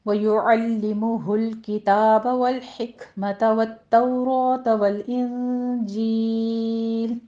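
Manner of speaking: slow melodic chanted recitation; Quran recitation. Shown as a demonstration. Teacher reciting the verse for students, slow chant. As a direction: chant the verse slowly and evenly.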